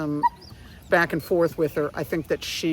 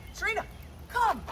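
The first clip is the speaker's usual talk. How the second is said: high happy call then praise; high cheerful praise for the dog; 5 semitones higher, 4 dB quieter, slower than usual. Real, not acted.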